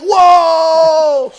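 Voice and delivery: loud, high whoa with a laugh; shocked whoa. Retold or imitated. Reacting to the stabbing.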